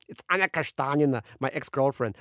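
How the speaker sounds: high-pitched, theatrical explainer; breezy Oleg. A narrator's reading. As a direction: brush off concern and bustle away.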